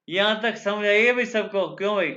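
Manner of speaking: calling out to class. Real, not acted. Teacher checking class understands.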